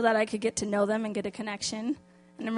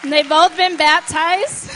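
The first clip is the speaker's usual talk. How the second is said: much louder, joyful and proud; excited announcement; 6 semitones higher, 13 dB louder than usual. Real, not acted. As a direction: announce it with real excitement to the church.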